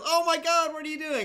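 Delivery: high, loud horrified outburst; shocked kid. Acted. Kid walks in on his dad in a joke, shocked outcry. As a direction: cry out in shock, pitch way up.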